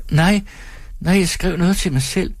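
put-on high, childlike woman; small thin voice, pitched well up; childish thin voice. A narrator's reading. Timid audience member answers the performer, childish thin voice. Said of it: "Hendes stemme er barnlig og tynd".

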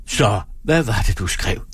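plain reading voice

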